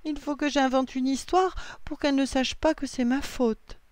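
whining. A narrator's reading. Lily blames herself for losing hat, whining. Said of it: "gémit Lily".

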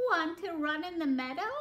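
a hint of an eager storybook sheep; lightly voiced sheep. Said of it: "bleated the sheep".

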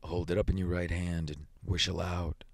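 lightly voiced low, soft soldier; solemn sergeant major. A narrator's reading. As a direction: instruct gravely, without raising the voice. Soldier gives solemn instructions.

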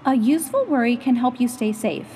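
plain reading voice

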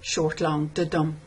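"short, long, da, dum" with the weight falling on "long" and "dum"; iambic beat. Shown as a demonstration. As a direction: tap out "da, dum" as a light beat then a heavy one. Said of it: "I hear this as a basically iambic rhythm".

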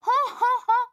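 high, loud villain laugh; cartoon villain's triumphant laugh; gloating laugh. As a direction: laugh at the heroes' trouble.

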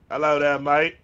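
cheery Australian hello, a bit loud; Australian accent. Pretend. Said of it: "Down under".

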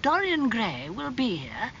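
high, unhurried society lady; lifted, leisurely storybook aunt; inviting aristocratic aunt. A narrator's reading. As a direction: offer an introduction as a genteel aunt.

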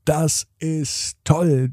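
plain reading voice

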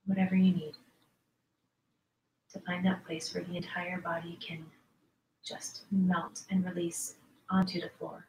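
soft, unhurried yoga nidra voice with long pauses; guided meditation. Acted. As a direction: ease listeners gently into letting the body go. Leads yoga nidra relaxation.

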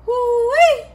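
high, loud delighted whoop; excited whoop. Real, not acted. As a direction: let out a thrilled holler. Delighted cry over the taste.

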